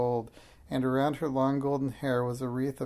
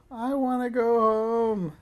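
loud, high, drawn-out wail; high childish bawling; howling; 10 semitones higher, 6 dB louder, slower than usual. A narrator's reading. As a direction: howl it slowly like an unhappy child. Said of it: "George began to howl".

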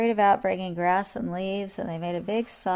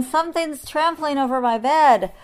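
loud, raised, distressed complaint; howled; 7 semitones higher, 9 dB louder than usual. A narrator's reading. Lion trampled in his bed, howled. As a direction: wail it out, outraged. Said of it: "howled the lion".

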